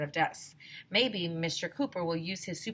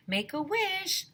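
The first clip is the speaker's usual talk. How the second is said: firefighter; 12 semitones higher, slower than usual. A narrator's reading. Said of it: "one of the firefighters told".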